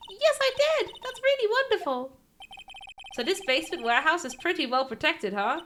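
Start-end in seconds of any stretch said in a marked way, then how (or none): 0.1-2.2 put-on high girlish voice delighted at a tiny model; little girl Pearl voice. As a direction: gush like an awed young girl. Pearl answers.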